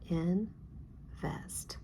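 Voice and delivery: "Invest" softly as in, pause, vest; split into syllables. Shown as a demonstration. Teacher sliding a finger under "Invest", syllable by syllable. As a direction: read "Invest" in two soft, separate pieces. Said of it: "Take your finger and slide".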